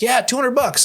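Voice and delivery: high, unbothered Greg; Greg shrugging. Retold or imitated. Greg admitting the pawn price, high and unbothered. Greg voiced in retold story.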